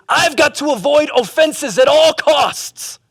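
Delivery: high, hammed-up declaration; overwrought vow. Preacher mocking the avoid-everything mindset, high overwrought vow. Acting the wrong attitude to offenses.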